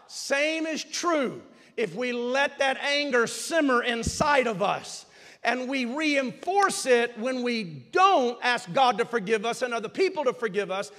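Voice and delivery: ordinary delivery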